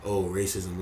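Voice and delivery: low, soft mock brush-off; mock dismissive. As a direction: imitate someone waving it away. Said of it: "a lot of people just dismiss that".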